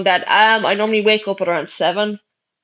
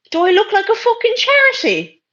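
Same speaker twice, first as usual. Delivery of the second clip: high, incredulous retort; scornful indignation; 10 semitones higher than usual. Real, not acted. Streamer rejecting a viewer's girlfriend request, scornful. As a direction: pitch climbing in outrage.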